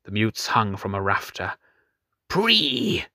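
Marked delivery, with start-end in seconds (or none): 2.3-3.1 high, shrill-pitched animal cry; wild cry. Acted. Mute on the rafter cries out as he swings, high wild cry. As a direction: cry out like a broken madman. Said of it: "he cried".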